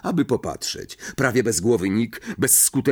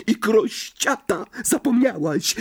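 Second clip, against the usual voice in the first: high-pitched goading jibe; high, needling imp voice; taunting hiss; 13 semitones higher than usual. A narrator's reading. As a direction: hiss it spitefully into an ear. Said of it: "syknął jej w ucho Irytek".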